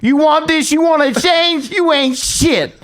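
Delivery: high, drawn-out imitation of a goading coach; in-your-face taunting. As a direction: goad him like a merciless drill coach. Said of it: "gets in my ear and goes".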